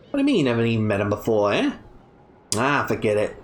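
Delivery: put-on light Australian accent, brash young thief; a scrappy Aussie girl, a hint of swagger. Kid voiced with Australian accent.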